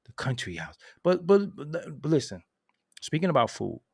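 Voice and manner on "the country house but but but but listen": ordinary talk, nothing marked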